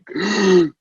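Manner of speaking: a high, sudden gasp for air; sharp gasp. Retold or imitated. As the OR doors open, sharp gasp for air. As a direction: gasp like surfacing after holding breath.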